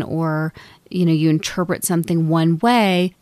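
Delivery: normal talking voice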